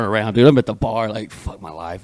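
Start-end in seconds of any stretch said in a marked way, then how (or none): 1.3-2.0 low, barely audible self-pity; very soft, sunken aside; retold despair. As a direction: mutter it to yourself, defeated. Retells despairing at the bar.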